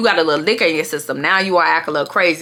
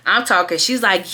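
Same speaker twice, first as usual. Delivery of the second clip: ordinary talk, nothing marked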